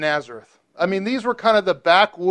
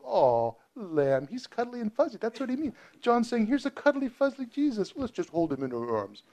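cutesy mock voice; 6 semitones higher, 7 dB quieter than usual.

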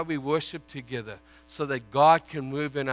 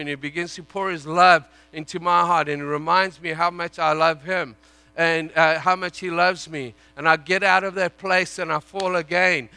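loud, driving sermon voice; fervent preaching; 3 semitones higher, 8 dB louder than usual. Acted. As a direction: preach it hard to the congregation. Preaching at full force.

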